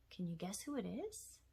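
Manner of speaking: ordinary talk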